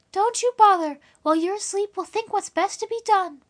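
put-on high young girl, eager to help; a kind girl reassuring the guest; girl's voice. Dora reassuring the stranger, high and eager. Dora's voice in the read story.

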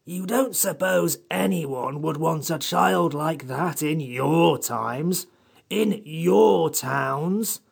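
high, irritated creature, slowing at the end. Acted. Samiad scorning the children's own times, irritated. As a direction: scold testily, dragging out the last phrases. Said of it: "said the Samiad in irritated tones".

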